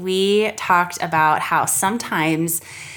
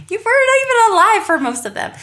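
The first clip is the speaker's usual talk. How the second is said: high, loud, fast teasing retort; laughing comeback; 6 dB louder, faster than usual. Real, not acted. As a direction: fire back through laughter.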